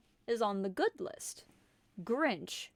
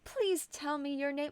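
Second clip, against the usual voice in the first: yet another Carol voice; 7 semitones higher than usual. Acted. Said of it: "three fucking voices at this point".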